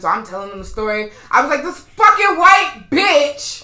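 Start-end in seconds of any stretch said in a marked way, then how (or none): 1.6-3.6 high, loud, stretched-out insult; angry outburst. Retold or imitated. Rider telling police about the woman, loud and angry. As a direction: retell an outburst, each word dragged and loud.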